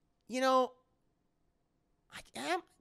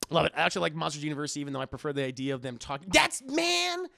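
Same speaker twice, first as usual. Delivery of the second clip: ordinary delivery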